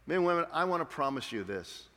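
ordinary talk

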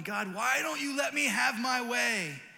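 high, loud, slow whining complaint; mock tantrum shouted upward; childish whine at God. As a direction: whine like a stubborn toddler. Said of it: "shaking your fist at God going".

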